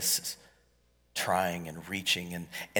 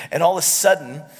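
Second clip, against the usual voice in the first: loud, higher, driving sermon voice; preaching at full force; 7 semitones higher, 8 dB louder than usual. Acted. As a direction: preach it out to the whole room.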